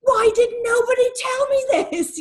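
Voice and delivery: mock-wailing amazement with a crying edge; astonished discovery. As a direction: replay the shock of a young woman just finding out. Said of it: "early twenties that I was like".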